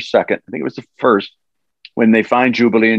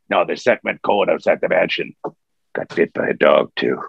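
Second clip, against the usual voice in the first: quiet Wolverine line; 6 semitones lower than usual. Retold or imitated. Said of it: "it's so quiet".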